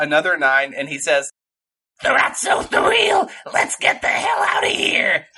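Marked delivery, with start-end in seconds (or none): 2.0-5.3 high, airy villain voice; sinister one-liner; menacing character voice. Acted. Another Zog one-liner.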